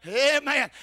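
ordinary talk

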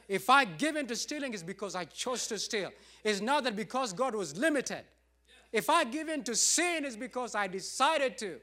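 loud rising declaration on I; preaching at full force. Real, not acted. Pastor preaching to his church, rising and loud. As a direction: preach it to the congregation, building.

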